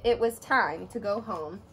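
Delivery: plain reading voice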